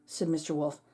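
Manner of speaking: ordinary delivery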